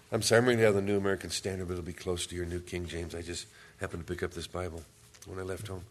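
ordinary talk, nothing marked

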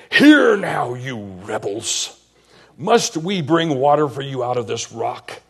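a sharp loud cry of "Hear now", then scolding; angry rebuke. Acted. Moses scolding the grumbling people, loud and sharp. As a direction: scold the rebels from the rock.

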